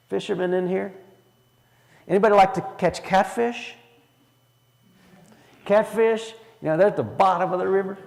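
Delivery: ordinary talk, nothing marked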